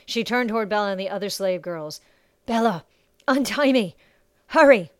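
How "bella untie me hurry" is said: raised, louder urgent call, building; urgent command. Acted. Bound Sasha orders Bella to untie her, urgent and loud. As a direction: urge her with growing force, ending in a shout. Urging captive to free her.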